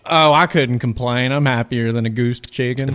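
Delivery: a slow, folksy southern drawl; southern accent. Acted. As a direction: lay on a light country saying, slowly. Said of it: "[name]'s talking in a southern accent".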